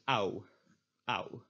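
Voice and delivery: "ow" like the vowel in English how; Dutch AU diphthong. Shown as a demonstration. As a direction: say "ow" as in ouch. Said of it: "This is pronounced as".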